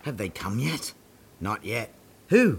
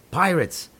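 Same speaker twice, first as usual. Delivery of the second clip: ordinary talk, nothing marked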